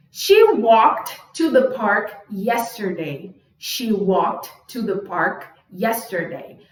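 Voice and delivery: slow, spaced-out example sentence; slow model reading. Shown as a demonstration. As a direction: read it out slowly for learners. Teacher reads example sentences slowly.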